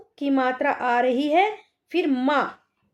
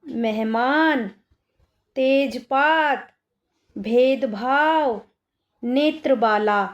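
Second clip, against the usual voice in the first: slow word dictation; slower than usual. Shown as a demonstration. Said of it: "आगे लिखा हुआ है".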